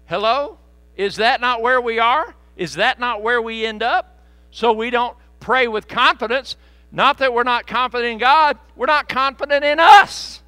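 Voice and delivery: loud, high preacher's cry; full-voiced revival preaching; preaching at full force. Real, not acted. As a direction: lift the congregation with fervour.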